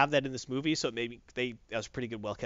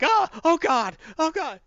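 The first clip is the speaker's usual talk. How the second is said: much higher, louder panicked shriek; Skrull scream; 12 semitones higher, 7 dB louder, slower than usual. Acted. The Skrull shrieks as the cat rubs his legs.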